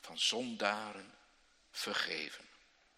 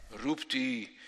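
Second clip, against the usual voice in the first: ordinary talk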